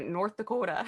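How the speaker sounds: North Dakota accent. Shown as a demonstration. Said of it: "'Cause that's how they say it there".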